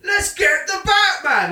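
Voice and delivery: high, loud, slow battle cry; top of their lungs. Retold or imitated. Mocking fifty cops charging, a loud slow bellow. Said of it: "at the top of their lungs".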